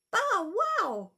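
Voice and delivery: squeaky high bow wow; high puppy bark. Acted. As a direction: yap like a tiny puppy. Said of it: "Puddles is coming too".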